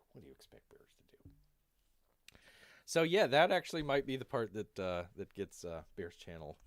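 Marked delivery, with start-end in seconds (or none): 0.1-1.3 very low, whispered repeat; muttered echo. Acted. Bear repeats the excuse under the breath, muttered echo. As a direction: drop to a hushed, low whisper.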